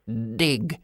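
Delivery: put-on low, soft old grandpa; a quiet old man's command. A narrator's reading. Grandpop voiced, one word.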